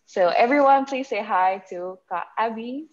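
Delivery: normal talking voice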